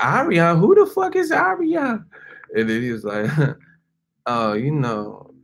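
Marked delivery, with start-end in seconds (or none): 0.0-2.0 high shrill-pitched woman yelling; furious girlfriend squeal. Retold or imitated. Girlfriend hears another woman's name, screeching much higher. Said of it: "And she's like". 4.3-5.3 slow, smooth cover-up; slow talking. Retold or imitated. Mace covers his slip to girlfriend, slow smooth talk. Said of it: "Mace slow talking that".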